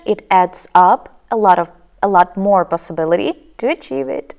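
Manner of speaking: continuous run until the breath gives out; one-breath delivery. Shown as a demonstration. Teacher demonstrates smoother speech, all on one breath. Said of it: "with as much oxygen".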